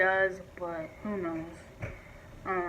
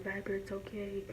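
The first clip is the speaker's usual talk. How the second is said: soft soothing; 12 dB quieter than usual. Retold or imitated. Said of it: "like, holding him and, like".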